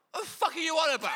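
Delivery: high, loud shouted heckle; angry heckler shout. Retold or imitated. Heckler in Peterborough yelling at the comic, loud shout. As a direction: bellow it like a fed-up heckler. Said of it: "he went".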